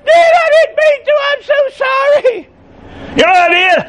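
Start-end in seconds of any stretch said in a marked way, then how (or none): 0.0-2.5 put-on high, crying plea of a little boy; sobbing child. Little son sobbing to his dad after breaking a window, high crying plea. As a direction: cry it out like a sorry child. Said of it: "he was just weeping and sobbing".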